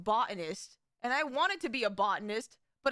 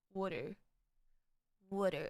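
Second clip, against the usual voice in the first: New York water; 7 semitones lower, 4 dB quieter than usual. Shown as a demonstration. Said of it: "they say water like water".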